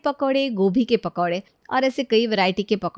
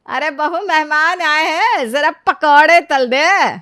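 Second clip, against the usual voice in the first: high, loud, drawn-out order to the daughter-in-law; bossy mother-in-law call; 6 semitones higher, 8 dB louder, slower than usual. Acted. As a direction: call out slowly to the bahu to fry pakoras. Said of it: "Sushma पहली बात क्या कहती है". (बहू को ऊँची, धीमी आवाज़ में पुकारती सास)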